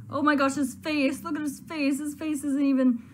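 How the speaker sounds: high, loud exclamation at a shocking sight; real amused shock. Real, not acted. Gamer sees the character's glitched face, high amused shock. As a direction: blurt it out, about to crack up.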